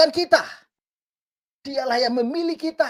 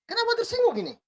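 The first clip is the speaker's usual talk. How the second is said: incredulous question; 11 semitones higher, much faster than usual. Real, not acted. Mocking offended netizens.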